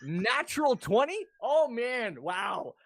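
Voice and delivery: loud, high, slow disbelief; astonished and half laughing; amazed. Real, not acted. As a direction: react stunned to an incredible roll. Amazed at a natural twenty.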